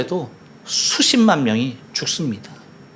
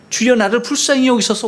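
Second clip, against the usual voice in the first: higher pitched plea for mercy; pleading cry; 8 semitones higher than usual. Acted. Preacher voicing the blind sinner's confession, raised plea. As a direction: beg the Lord with a lifted voice.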